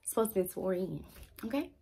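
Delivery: ordinary talk, nothing marked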